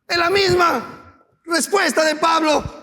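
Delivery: high, loud, impassioned sermon build; preaching at full force. Acted. Pastor rousing the congregation, impassioned loud preaching. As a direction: preach it, rising and pressing the congregation.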